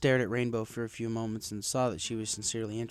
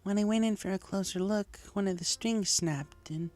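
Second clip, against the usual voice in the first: high, lightly voiced mare, uneasy; Lyra, shaken unicorn; 9 semitones higher than usual. Lyra in hospital telling Rainbow what happened, uneasy high voice. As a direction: recount the scare, a little rattled. Lyra tells of her lyre.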